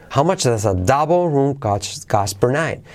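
slow tune with "double" lifted, "night" rising; question intonation. Shown as a demonstration. Teacher repeating question intonation, "double" and "night" accented. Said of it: "la entonación, como es una pregunta".

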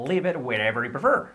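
quick, linked example sentence; said quickly. Shown as a demonstration. Teacher shows the phrase said fast, quick and linked. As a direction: run the phrase together quickly. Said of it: "Dicho rápido".

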